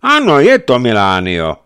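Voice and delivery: loud, high, decisive declaration; emphatic, no-hesitation confirmation; unhesitating confirmation. A narrator's reading. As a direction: confirm it at once, loudly. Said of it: "potvrdil bez rozmýšlení řádový vyslanec".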